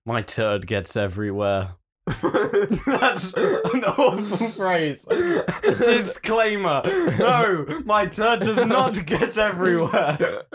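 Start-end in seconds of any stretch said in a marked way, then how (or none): 1.9-10.4 giggling through the words; laughing. Real, not acted. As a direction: laugh while protesting. Laughing at own phrase.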